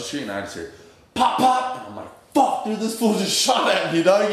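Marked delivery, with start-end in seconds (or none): 1.1-1.8 sharp pop pop gunshot noises, high and loud; mock gunfire, yelped high; imitated gunshots. 2.3-4.3 imitating his own panic, much higher and louder; mock panicked outburst; retold alarm. Retold reaction to being shot at.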